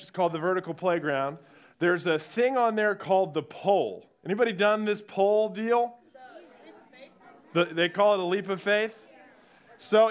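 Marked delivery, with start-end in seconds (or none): none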